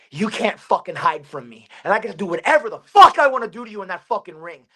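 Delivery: ordinary delivery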